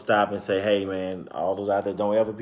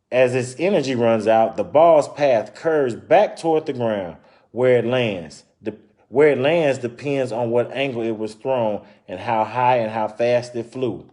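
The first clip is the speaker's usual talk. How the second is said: story narration; 6 dB louder than usual. Acted. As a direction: clear read-aloud storytelling voice. Reading picture book aloud.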